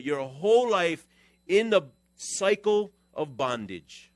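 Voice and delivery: loud, high, driving sermon push; preaching at full force. Acted. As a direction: hammer it home to the church. Preaching hard at the congregation.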